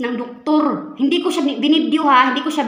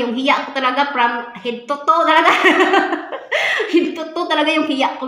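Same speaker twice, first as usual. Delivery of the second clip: higher and louder, laughing through embarrassment; 6 semitones higher, 6 dB louder than usual. Real, not acted. Vlogger laughing about her shame to viewers, laughing. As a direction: laugh at how mortified you were.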